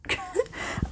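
silent giggle, all breath; embarrassed airy chuckle; breathy embarrassed laugh. Real, not acted. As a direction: laugh it off under your breath. Embarrassed by her mistake.

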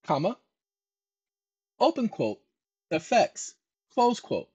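dictating. Shown as a demonstration. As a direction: spell out every quote mark for a typist. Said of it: "dictating with punctuation spoken".

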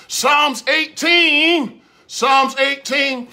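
drawn out. Acted. Preacher drawing out the reference.

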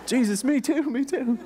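high-pitched laughing mock plea; hammed-up, high and giggly; eager follower. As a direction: squeal it like a timid copycat begging to join. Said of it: "And then I'll be like".

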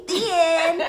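high, loud, laughing announcement; gleeful sign-off. Acted. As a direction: proclaim the story's close with glee. Announces close of the story.

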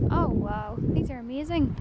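usual speaking voice

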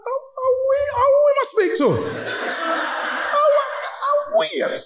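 high, fast mocking whine of the other driver; mock whiny voice. Mocking the driver with a high whiny voice. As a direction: squeak it out like a flustered fool.

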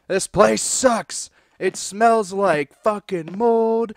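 drawn-out, weary clerk complaint; slow fed-up shop clerk; slow grumble. Acted. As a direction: drag the words like a fed-up worker.

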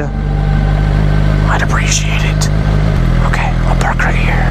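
hushed nervous whisper. Real, not acted. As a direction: whisper so the ghosts won't hear. Alone at night in cemetery.

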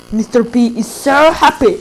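slow put-on game-character voice; Gale. Player showing Gale voice lines they know, slow impression. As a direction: draw out "so happy" like a cartoon catchphrase. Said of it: "Gale'ın seslerini de bazılarını biliyorum".

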